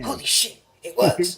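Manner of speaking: sudden loud high exclamation; excited outburst. Real, not acted. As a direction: burst out in delighted surprise. Excited outburst after whisper.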